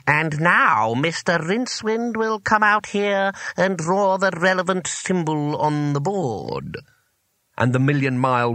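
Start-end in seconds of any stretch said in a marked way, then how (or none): 0.1-6.8 high, slow, drawn-out summons; teacher's drone. A narrator's reading. Old teacher calling a pupil to the board, droning. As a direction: intone it as a dreaded old schoolmaster. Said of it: "the voice saying".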